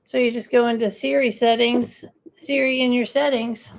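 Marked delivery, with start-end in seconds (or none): none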